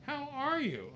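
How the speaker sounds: high, bright-voiced imitation of Wendy greeting him; Wendy's high greeting. Imitating Wendy greeting John warmly backstage, high. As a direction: chirp it up high like an excited hostess. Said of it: "She goes".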